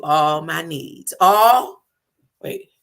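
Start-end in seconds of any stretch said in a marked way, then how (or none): 1.2-1.8 "All" punched out much louder; stress on ALL. Real, not acted. Speaker halts the affirmation to drive it home, emphatic "All". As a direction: belt out "All" on its own.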